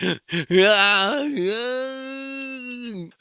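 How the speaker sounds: gasp. Retold or imitated. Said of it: "He's like".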